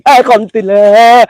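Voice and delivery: high, loud, slow frightened cry; scared shriek at a shape; startled cry. Real, not acted. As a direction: yelp it as if you saw a ghost. Spotting a ghost, startled cry.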